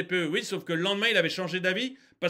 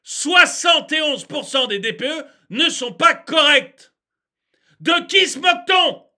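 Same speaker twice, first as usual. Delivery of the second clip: loud, emphatic indignation; outraged repeat; 6 semitones higher, 9 dB louder, slower than usual. Real, not acted. Ranter repeats the statistic to the audience, outraged. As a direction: hammer it out, outraged.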